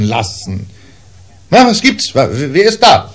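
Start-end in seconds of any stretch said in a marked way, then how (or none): 1.5-3.1 high, loud startled cry of a man just woken; startled call. A narrator's reading. Father, woken by the creaking door, calls out startled. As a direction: jolt awake and call out toward the creaking door. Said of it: "rief mein Vater".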